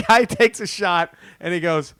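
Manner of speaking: ordinary talk, nothing marked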